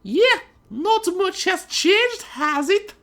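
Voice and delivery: very high, loud, slow prissy voice; haughty heiress greeting. A narrator's reading. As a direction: greet her rival from on high. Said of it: "Weiss asked nonchalantly".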